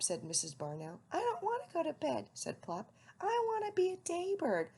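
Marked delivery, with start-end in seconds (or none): 1.1-2.3 high fast owlet protest. A narrator's reading. Owlet protesting bedtime to mother, high and fast. Plop voiced in story. 3.2-4.7 high owlet voice; little owl insisting, pitched high. A narrator's reading. Owlet telling mother, high.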